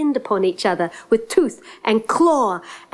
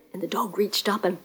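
ordinary delivery

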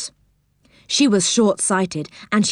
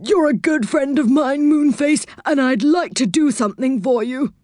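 put-on higher, kindly old saucepan man; 6 semitones higher than usual. A narrator's reading. Saucepan to Moonface, higher kindly voice. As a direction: reassure your friend as Saucepan.

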